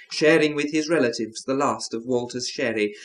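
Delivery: plain reading voice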